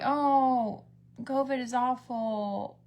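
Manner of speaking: slow, droning whine; mock whining. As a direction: drag out the complaint mockingly. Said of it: "seems like she whines a lot".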